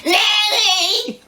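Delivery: bright high "Nanny!" shout; calling nanny. Retold or imitated. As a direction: call out like an eager granddaughter. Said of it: "She was over here".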